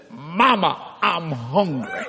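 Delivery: put-on slow, drawn-out child's plea; waking child. Boy wakes from coma and calls mother, slow drawn-out words. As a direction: stretch it out like a boy just waking.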